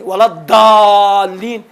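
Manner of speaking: الضالین with a full hard daal, very long aa; zaad as full daal. Shown as a demonstration. Teacher showing the unacceptable recitation, الضالین with full drawn-out daal. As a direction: hit a daal in الضالین and hold the vowel. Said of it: "پورا دال کا تلفظ کرے".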